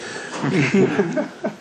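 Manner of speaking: high, soft chuckle; real laugh. Real, not acted. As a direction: laugh lightly at your own examples. Laughs at his dream examples.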